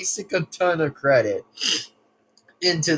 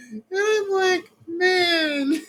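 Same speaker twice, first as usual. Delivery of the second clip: laughing; 11 semitones higher, much slower than usual. Real, not acted. Laughing while quoting himself.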